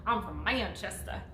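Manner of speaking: low retold Manchester accent; fake British accent. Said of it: "he put on a fake British accent".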